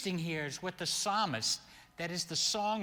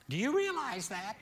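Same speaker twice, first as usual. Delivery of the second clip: emphatic challenge; 7 semitones higher than usual. Acted. Preacher challenging his listeners with a rising question. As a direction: push the question at them.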